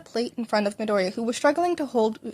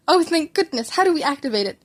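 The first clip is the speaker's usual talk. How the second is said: higher, louder relieved rush; 5 semitones higher, 4 dB louder than usual. A narrator's reading. Mother to doctor, relieved at the good news. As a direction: breathe out in relief and ask eagerly. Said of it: "a sigh of relief".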